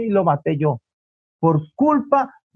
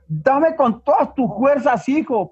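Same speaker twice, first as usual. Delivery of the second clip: slow, higher, emphatic motherly order; insistent command; 5 semitones higher than usual. Acted. Mother overrules son's protest, acted slow insistent command. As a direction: insist on every word, drawn out.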